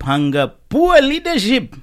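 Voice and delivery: poor leadership, emphasised. Shown as a demonstration. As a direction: stress the word poor. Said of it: "I emphasize that".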